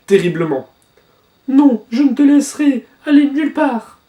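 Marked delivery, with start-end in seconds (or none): 1.5-4.0 raised, pleading manga heroine voice; high dramatic character voice. Acted. Heartbroken character shouting at Gantha, high and loud. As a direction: cry it out, much higher than the narrator.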